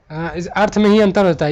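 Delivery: usual speaking voice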